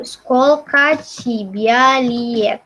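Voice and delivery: Skolka Cibialiet stretched out word by word; very slow dictation. Shown as a demonstration. Teacher writing the phrase for the class, dictating slowly. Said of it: "mau nulis aja biar kalian bisa tahu".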